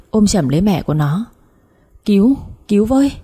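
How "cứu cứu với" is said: a raised cry for help, pitched up; calling for help. A narrator's reading. Daughter calls neighbours for help, raised frightened cry. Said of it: "Con Lan hét lớn".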